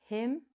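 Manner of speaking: "him" with a short I, as in "pin"; correct short vowel. Shown as a demonstration. Teacher showing the right word, "him" with short I. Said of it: "So we say".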